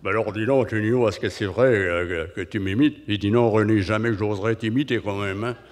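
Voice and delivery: René, hot potato voice. Retold or imitated. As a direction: talk like a hot potato in your mouth. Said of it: "une patate chaude dans la bouche".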